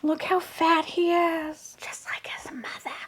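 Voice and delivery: high, slow squeak fading to whisper; overwhelmed fawning. Real, not acted. Doting owner calls the toy a son, squeaky fading coo. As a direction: let the coo thin out to a breath.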